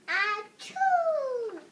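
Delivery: loud, high "achoo" falling away; huge mock sneeze. Gerald sneezes at Doctor Cat, huge mock sneeze. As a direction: explode into an acted sneeze.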